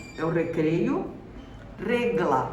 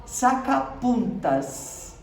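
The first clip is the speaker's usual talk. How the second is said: Sacapuntas drawn out slowly, syllable by syllable; teacher modelling Sacapuntas slowly; slow model word; 4 semitones higher, much slower than usual. Shown as a demonstration. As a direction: stretch out Sacapuntas for the class. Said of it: "Ó que interessante".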